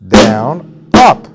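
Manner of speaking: teacher calling paradiddle strokes; called strokes. Shown as a demonstration. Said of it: "when you're doing a paradiddle".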